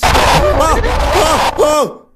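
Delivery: high, loud cries of fright; startled scream. Real, not acted. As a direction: yelp from a sudden scare. Jump scare in horror game.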